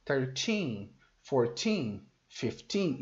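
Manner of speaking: put-on thirteen, fourteen, fifteen with a long, falling TEEN; stress on TEEN. Shown as a demonstration. Teacher showing the stress on English numbers, TEEN stressed. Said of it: "o estresse tá no final da palavra".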